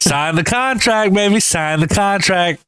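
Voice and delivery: high, drawn-out mock salesman line; hammed-up catchphrase. Teasing his friend with a money catchphrase, hammed-up. Mimics wrestling-style catchphrase, stretched out.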